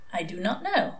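soft, hollow trance voice; hushed, vacant answer; entranced reply. A narrator's reading. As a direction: answer blankly, as if hypnotised. Entranced ex-nun answers questions.

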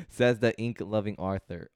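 ordinary delivery

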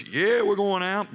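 imitating an irritated captain; mock gruff captain. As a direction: stretch the first word in exasperated mock retort. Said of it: "he had a lot of choice words".

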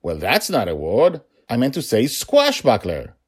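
high, baffled self-questioning; puzzled at his own slip of the tongue; mock-astonished father, pitch jumping; surprised at himself. A narrator's reading. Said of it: "He seemed surprised".

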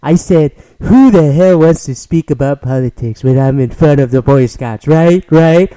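quoting Trump to the Boy Scouts, lightly imitated bluster; Trump impression. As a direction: play Trump boasting to the crowd, half-mocking. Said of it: "He said".

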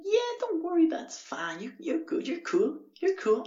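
very high falsetto impression; exaggerated high-pitched neighbour; reassuring neighbours. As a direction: coo reassurance in a hammed-up squeaky pitch. Voices the forgiving neighbours.

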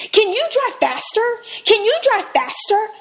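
high, loud impatient plea; pushy demand. Retold or imitated. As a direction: demand it through the partition. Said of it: "and I said".

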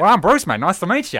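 loud high Aussie bloke; Australian accent. Acted. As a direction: introduce yourself as a brash Australian. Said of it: "the Aussie way, mate".

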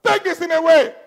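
high, loud imploring cry; begging. Acted. Paul praying over four years, loud begging. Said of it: "Begging, begging".

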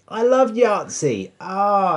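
high, slow gushing; excited. Real, not acted. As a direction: gush about it happily. Excited at remembering Yahtzee.

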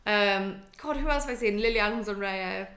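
ordinary talk, nothing marked

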